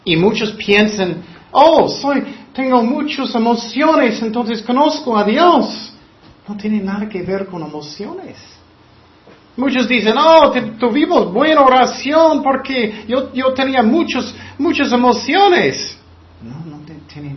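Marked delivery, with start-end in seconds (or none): 1.5-5.9 higher, louder gushing mock voice; mocking emotional believer. Preacher to congregation, parodying a feelings-driven believer, high and gushing. 10.2-16.0 higher, louder gushing mock voice; mocking emotional believer. Preacher to congregation, parodying a feelings-driven believer, high and gushing.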